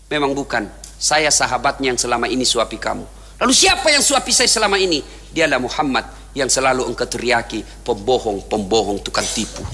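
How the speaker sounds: tearful low reply. Acted. Abu Bakar tells the blind man the truth, tearful. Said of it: "Abu Bakar menetes air matanya".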